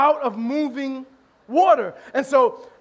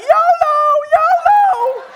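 very high, loud mock squeal; whooping party girls; 13 semitones higher, 6 dB louder, much slower than usual. Comedian mimics girls on bouncing boat, whooping squeal. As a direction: shriek it out as a thrilled girl, hammed up. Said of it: "the girls in the front are like".

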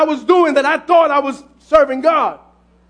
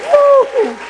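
high, ringing "woo"; quick high cry of praise; exultant whoop; 10 semitones higher than usual. Real, not acted. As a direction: let out a high preacher's whoop. Preacher whoops after the story's climax.

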